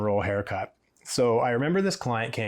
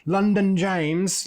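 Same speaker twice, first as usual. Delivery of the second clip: slow, higher, savouring James from London; posh London stylist; 8 semitones higher, 3 dB louder, slower than usual. Pretend. Offering the client a glamorous London stylist, a slow, higher, savouring voice. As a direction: dangle the name like a fancy prize.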